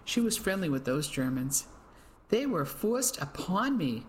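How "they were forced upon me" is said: a slow, higher, bitter spit of words; spat out. A narrator's reading. Accused woman before the crowd, spitting the words out. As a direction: spit each word out, slow and wounded. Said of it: "Missus Adams spat out the words".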